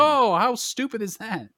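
mocking critics. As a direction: parody the whiners with a scoff. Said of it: "And they're all like".

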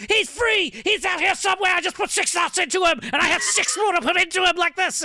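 high frantic doctor's cry; panicked, high-pitched mock raving; Doctor Loomis. Host imitates the doctor heard in the background, frantic high voice. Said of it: "you can hear Doctor Loomis kinda in the background".

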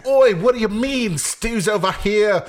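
loud, high fake British accent; Stu. Host standing in for absent co-host, loud mock British accent. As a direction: shout it in a hammed-up UK accent. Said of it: "fake Stu accent".

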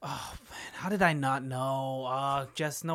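barely audible stunned whisper; hushed dismay. Acted. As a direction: breathe it out unvoiced. Hushed reply at open house.

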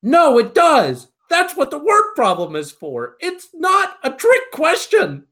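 high, loud, slow exasperated insistence; retold exasperated retort. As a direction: hammer it home to the know-it-all kid. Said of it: "And I'm like".

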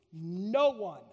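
NO ONE drawn out low and weighty; emphatic. Retold or imitated. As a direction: land hard on "No one" as a final answer.

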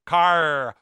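CAR said loud with a hard R. Shown as a demonstration. Showing how England once spoke, CAR with hard R. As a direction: hit the final R hard in CAR. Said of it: "spoke with a hard R".

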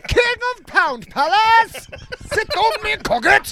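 high, slow herald voice; mock royal herald. Host crowning his friend, high mock herald. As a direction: proclaim it like a court crier.

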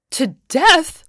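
high, loud shocked shriek. A narrator's reading. Woman horrified that the Lord will kill it, shrieking. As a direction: cry it out in horror. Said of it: "shrieked the woman".

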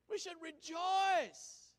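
high, loud and slow; slow loud rejoicing; drawn-out exhortation. Real, not acted. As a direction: stretch it out joyfully.

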